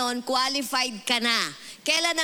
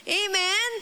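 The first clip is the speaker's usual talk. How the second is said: ordinary delivery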